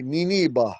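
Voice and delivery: "Niniba" with "nee-nee" opening; modern way. Shown as a demonstration. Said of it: "now in modern days".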